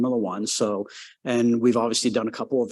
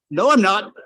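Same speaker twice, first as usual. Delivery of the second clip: mock denial; 9 semitones higher, 7 dB louder than usual. Eddie voiced high and loud.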